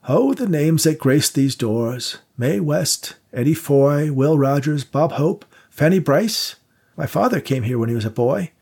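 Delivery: put-on an old man lost in fond memories; a wistful elderly host recalling the old stars; nostalgic old manager. Victor reminisces about vaudeville stars.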